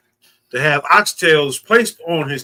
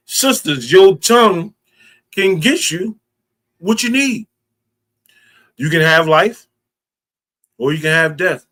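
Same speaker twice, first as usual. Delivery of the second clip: preaching; 2 semitones higher, 5 dB louder than usual. Acted. Preacher calling on the sisters, building loud. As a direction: call out to the sisters from the pulpit.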